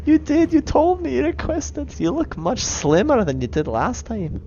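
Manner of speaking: high-voiced protest to a god; timid Irish worshipper, insistent. Worshipper contradicting the god, high and insistent.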